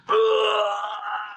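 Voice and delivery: a high, crying laugh bursting out; squealing laugh at the stage; high burst of laughter. Real, not acted. Laughing at hypnotized guys.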